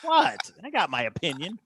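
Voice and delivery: pit bull attitude. Retold or imitated. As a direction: imitate a feisty woman bristling at a question. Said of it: "she's kind of a pit bull".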